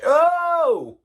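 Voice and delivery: high, loud exclamation of thrill; excited whoop. Retold or imitated. Fan describing the song's rush, high excited yelp. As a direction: cry out like you're taking flight. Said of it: "and you're like".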